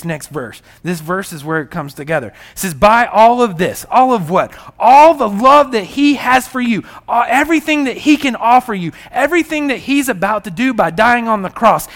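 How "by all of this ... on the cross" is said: loud, higher fervent preaching; preaching at full force. Real, not acted. Preacher exhorting congregation about the cross, fervent and loud. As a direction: build the sermon to a ringing peak.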